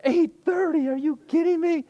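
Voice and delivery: high, incredulous yelp; can't believe the clock says that; shocked disbelief. Retold or imitated. Pastor waking late, shocked at the time, high disbelief.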